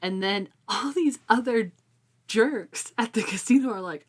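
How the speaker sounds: ordinary talk, nothing marked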